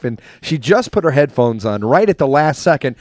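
usual speaking voice

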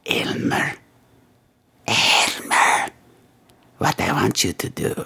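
whispered name, drawn out; whispering a caller's name in menace; creepy whisper. Acted. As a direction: hiss his name like a threat.

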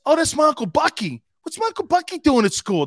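high, loud, delighted; excited mock surprise. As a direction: greet him like family, high and loud. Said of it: "I go like this".

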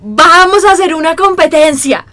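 high, drawn-out imitation of a rowdy friend; mock announcing shout. Imitating Mateo proposing a competition, drawn-out mock shout. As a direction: stretch out a mock rallying yell.

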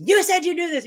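high, loud cry of protest; loud, desperate cry; retold scream at God. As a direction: raise it to a pleading shout at the heavens. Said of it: "I would scream the scriptures".

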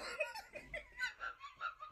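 giddy high-pitched laugh; high squealing laugh. Real, not acted.

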